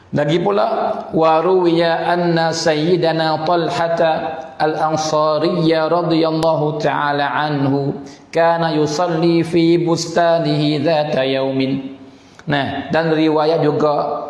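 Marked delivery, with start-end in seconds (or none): 1.1-11.9 slow, measured recitation on a level tone; Arabic text reading. Acted. Teacher reads the Arabic account to listeners, measured recitation. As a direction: read the Arabic out slowly and evenly.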